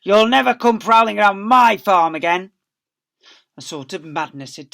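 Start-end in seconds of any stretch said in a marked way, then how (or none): 0.0-2.5 loud furious threat; angry yell. Acted. Boggis yelling threats at the fox. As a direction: roar it down the hill. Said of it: "yelled Boggis".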